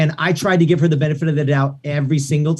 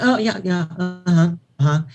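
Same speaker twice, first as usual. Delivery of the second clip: dismissive; 2 semitones higher than usual. Retold or imitated. Diva brushing off the producer, imitated dismissively. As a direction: toss it away like she can't be bothered. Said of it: "Like, very dismissive".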